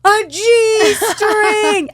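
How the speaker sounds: put-on high, loud scandalized child; kid's dramatic reveal. Son tells mom what he found, high and loud. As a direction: announce it like a shocked kid. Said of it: "Forrest is very dramatic".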